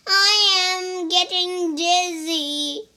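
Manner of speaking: high, loud squeaky worm cry; child voicing a dizzy worm. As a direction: wail it like a tumbling little worm. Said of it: "cried the worm".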